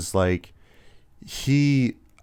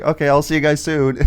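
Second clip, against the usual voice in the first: nonchalant; 6 semitones higher than usual. Retold or imitated. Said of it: "he's so nonchalant".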